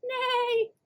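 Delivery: high whinnying neigh; horse neigh. Acted. Little horse answering the kids, high neigh.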